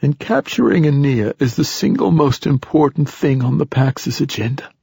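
put-on high, raspy old man; urgent old poet's rasp. A narrator's reading. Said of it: "rasped the old poet".

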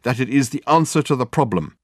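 a touch of earnest bluster; self-justifying detective. Acted. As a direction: justify yourself insistently.

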